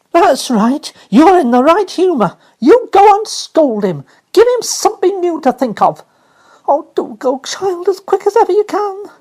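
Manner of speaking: high, loud, hurried encouragement; relieved nurse egging her on; eager urging. Acted. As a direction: push the child out the door.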